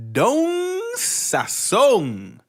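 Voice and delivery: put-on high, slow, drawn-out Spanish accent. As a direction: stretch the name out big in a Spanish accent. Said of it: "with the extra accent on it".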